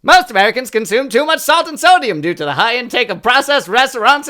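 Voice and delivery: put-on old Hollywood transatlantic read, easing off; transatlantic accent. Shown as a demonstration. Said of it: "A transatlantic accent?".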